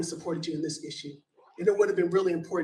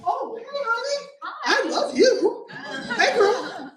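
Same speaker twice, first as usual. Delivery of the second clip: much higher and louder, laughing; delighted greeting; 5 dB louder, slower than usual. Real, not acted. Commenter breaks off to greet a friend in the room, delighted and laughing.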